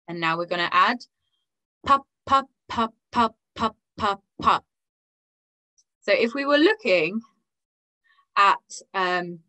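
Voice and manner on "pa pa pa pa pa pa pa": quicker run of "pa" on the off beats; increased speed. Shown as a demonstration. Teacher demonstrating the quicker rhythm, increased speed. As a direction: double up the "pa" beat, stepping down in pitch. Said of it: "we're gonna increase the speed".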